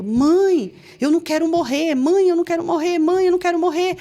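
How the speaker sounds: high, loud, desperate pleading; retold child's cry. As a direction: re-enact a terrified child crying out. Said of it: "ele começou a gritar assim". (grito aflito de criança, imitado)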